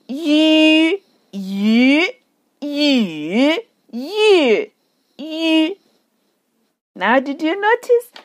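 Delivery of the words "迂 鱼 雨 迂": "一" as ü, level, rising, dipping, then short; Mandarin tone drill. Shown as a demonstration. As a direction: say "一" with rounded ü through the tones. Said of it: "I put different tones on them".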